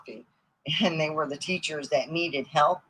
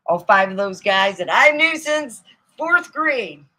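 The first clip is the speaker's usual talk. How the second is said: usual speaking voice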